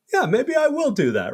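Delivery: high, breezy agreement; mock eager yes. Jay mimics his younger self agreeing, high and breezy. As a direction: toss it off high and casual. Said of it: "And I was like".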